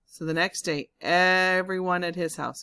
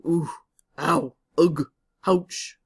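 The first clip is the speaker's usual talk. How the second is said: loud pained ow and ugh; groans of effort; 8 dB louder, much slower than usual. Acted. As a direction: strain and groan as you push. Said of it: "They're saying".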